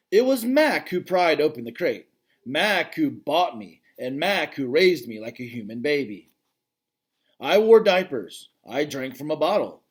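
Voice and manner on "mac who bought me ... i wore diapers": ordinary delivery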